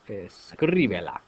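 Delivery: "escríbela" slowed, with the stress on CRÍ; stress third from end. Shown as a demonstration. Teacher practicing accent placement after counting uno, dos, tres, CRÍ stressed in "escríbela". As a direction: lean slowly on CRÍ in "escríbela". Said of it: "we're gonna put a little accent".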